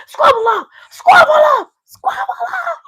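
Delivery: very high, loud yelled chant; imitated crowd shouting. Fans going bar for bar, imitated screaming chant. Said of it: "They're like".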